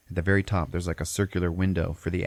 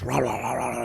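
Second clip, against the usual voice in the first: raised, repeated mock growling; imitated beast growl; 5 semitones higher than usual. Giant beast moving in the bushes, hammed-up growling. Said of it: "It's fucking growling and shit".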